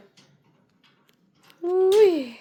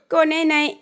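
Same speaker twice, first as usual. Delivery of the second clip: normal talking voice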